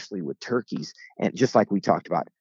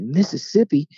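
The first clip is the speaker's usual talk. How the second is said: louder, higher questioning echo of MISSISSIPPI; surprised echo; 5 semitones higher, 5 dB louder than usual. Retold or imitated. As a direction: repeat it back in mock amazement. His surprised echo of her answer.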